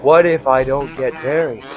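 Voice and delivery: ordinary talk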